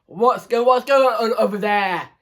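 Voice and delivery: teacher calling out to question the class; ringing teacher voice. A narrator's reading. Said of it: "Miss Mia's voice rang across the room".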